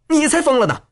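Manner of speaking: high-pitched woman's comeback; angry retort. A narrator's reading. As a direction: throw the insult straight back, pitched high. Woman retorts in mid-fight.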